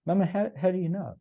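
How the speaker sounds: put-on high, earnest child's voice; imitating his young daughter. Daughter pressing her mother for proof, high childlike voice. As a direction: ask it like a curious eight-year-old.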